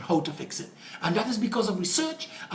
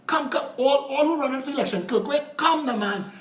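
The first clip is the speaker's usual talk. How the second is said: mock summons; 6 semitones higher than usual. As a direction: call them all forward like a showman. Mock summoning all the politicians.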